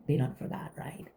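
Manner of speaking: hushed knowing whisper. Real, not acted. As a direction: whisper it like a secret. Whispered aside.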